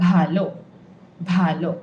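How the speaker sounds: "ভালো" with aspirated bh; breathy bha. Shown as a demonstration. Teacher drilling the aspirated bha sound. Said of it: "make sure you're saying it correctly".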